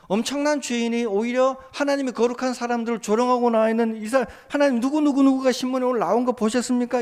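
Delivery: a believer praying over a newspaper story, high and loud; fervent prayer. Acted. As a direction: lament injustice to God at full voice. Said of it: "신문 기사를 들고 기도할 수 있어요".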